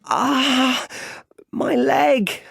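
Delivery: put-on high, loud child's wail; pained cry. A narrator's reading. Boy hurt at the bottom of the well, crying out in pain. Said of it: "he cries".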